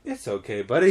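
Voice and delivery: low, soft calming voice; soothing reassurance. Retold or imitated. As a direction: soothe an upset child gently. Soothing reply to screaming boy.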